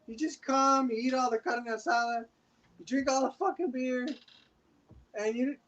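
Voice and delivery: light impression of a tequila-soaked relative scolding a freeloader; drunk party uncle. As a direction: play the tipsy cousin who loves you and scolds you. Acting drunk uncle at family party.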